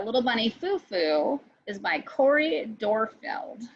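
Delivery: usual speaking voice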